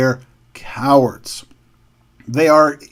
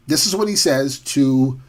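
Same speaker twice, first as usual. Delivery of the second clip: usual speaking voice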